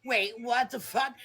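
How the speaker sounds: ordinary talk, nothing marked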